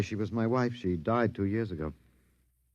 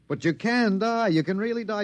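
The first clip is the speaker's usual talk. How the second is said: excited triumph; 9 semitones higher than usual. Acted. Taunting alien after death.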